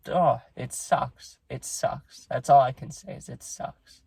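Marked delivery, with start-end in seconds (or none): 0.1-1.4 drawn-out, heavy-hearted groan of grief; real sorrow, dragged out slowly; slow sad sigh. Real, not acted. Grieving vlogger sighs over dog. 1.5-2.3 soft, breathy whisper of real sadness; real sorrow, said under the breath; whispered grief. Real, not acted. Grieving vlogger repeats it softly.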